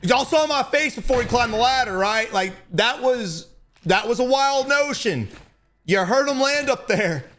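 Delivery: high, slow amazed recap; excited disbelief. Real, not acted. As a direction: marvel at the wild play.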